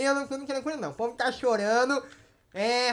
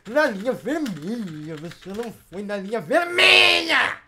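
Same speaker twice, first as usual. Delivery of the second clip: exasperated yelling; 2 semitones lower, 5 dB louder than usual. Real, not acted. Host berating player who ignored red line, yelling. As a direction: build to a loud, stretched final word.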